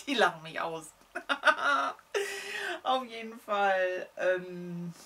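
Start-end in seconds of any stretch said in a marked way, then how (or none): 1.1-2.8 high bursting laugh. Real, not acted. Guests will laugh at her.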